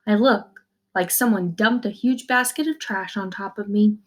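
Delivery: usual speaking voice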